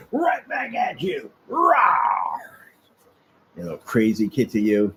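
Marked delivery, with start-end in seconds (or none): 0.1-2.4 slow growly playful roar back; mock cat growl. Real, not acted. Man growling back at his hissing cat, playful roar.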